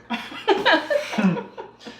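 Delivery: high burst of real laughter. Real, not acted. Toothpick joke, laughing.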